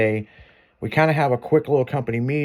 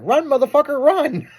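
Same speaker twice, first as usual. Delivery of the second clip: much higher, urgent mock yell; yelling run; 13 semitones higher than usual. Boss on the phone telling his worker to flee, mock yell. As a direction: bark it like a boss in panic.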